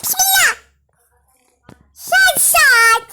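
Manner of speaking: high, sudden startled cry; startled yelp. Real, not acted. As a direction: jump at a sudden noise. Startled after a long silence.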